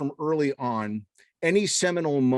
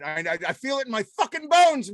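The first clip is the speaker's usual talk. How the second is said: much higher, thrilled and sure of it; real buzz of excitement rising up; excited; 9 semitones higher than usual. Real, not acted. Host hyped about a hit song.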